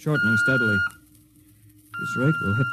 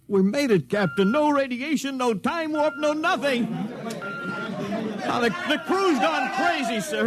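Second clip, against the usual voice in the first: higher, elated burst of relief; excited relief; 9 semitones higher, slower than usual. Acted. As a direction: tell the captain they made it, elated. Crew cheers surviving barrier.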